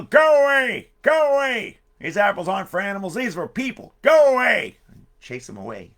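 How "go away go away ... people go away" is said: loud, high furious shout; angry farmer yelling. Acted. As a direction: bellow like a farmer chasing animals off. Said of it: "Farmer would come out and yell".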